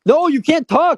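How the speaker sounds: high, loud scolding yell; playful shout at the contestant. Real, not acted. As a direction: cut them off sharply.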